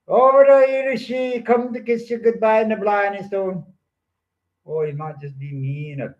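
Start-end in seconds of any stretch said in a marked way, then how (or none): 0.1-3.6 other fella. Retold or imitated. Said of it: "some other fella from over there".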